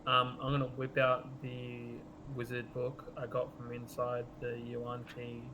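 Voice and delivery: ordinary talk, nothing marked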